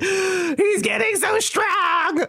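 high, slow, sobbing pride; teary gush. Retold or imitated. Woman over a calf photo, high slow teary gush. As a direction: cry out the words like a proud, weeping parent.

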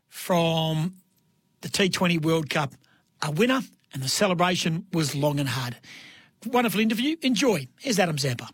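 normal talking voice

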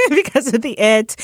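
amused, laughing repeat of someone's words; real laughter. Real, not acted. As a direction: say it through a laugh, pitch jumping up. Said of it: "I don't know why I'm laughing".

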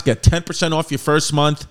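loud, punchy podcast ad read; sponsor ad read. Acted. Host reading the BetterHelp sponsor spot, loud ad voice. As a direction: sell it straight to the listeners, loud.

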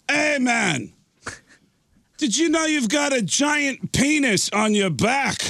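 high, slowed rock-singer voice. Retold or imitated. Rock singer telling the drummer about his tattoo, high and slow. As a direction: imitate him pointing it out. Drummer story, [name] quote.

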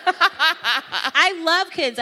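drawn-out laughing confession; laughing slow admission. Real, not acted. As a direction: laugh it out slowly.

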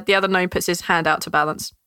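usual speaking voice

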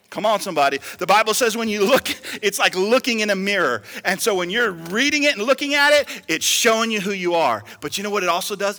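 loud, driving sermon delivery; rally the congregation, pressing each phrase; full-force preaching. Real, not acted. Preacher urging "come on, somebody", impassioned.

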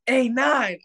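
high-pitched mock holler; taunting call. Field guy hollering at the player by number, taunting. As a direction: call out to him across the field.

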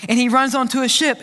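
normal talking voice

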